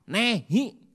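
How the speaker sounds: loud, high playful denial. Retold or imitated. Peter denying Jesus, loud playful no. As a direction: fling the refusal out. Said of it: "Petrus bilang apa".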